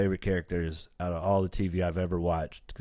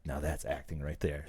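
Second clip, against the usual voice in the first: much softer, lower voice; imitated whisper; 6 semitones lower, 10 dB quieter than usual. Uncle Phil praising Will's scene, hushed murmur. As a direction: confide it like a private word of praise. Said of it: "Uncle Phil whispered into his ear".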